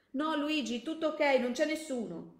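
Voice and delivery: loud, high, hasty all-clear; calling back. Acted. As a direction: shout back that all is fine. Calls back to comrade outside.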